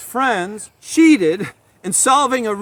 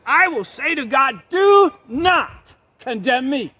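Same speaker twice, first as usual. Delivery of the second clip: slow, higher, louder demand; defiant Job; 5 semitones higher, 4 dB louder, slower than usual. Acted. Preacher voicing Job daring to accuse God. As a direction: spell out the accusation slowly.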